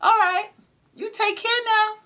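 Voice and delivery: high, sugary fake-polite goodbye; fake-nice farewell. Parting from someone who wronged her, fake sweet. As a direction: chirp it sweetly, none of it meant. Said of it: "I was like".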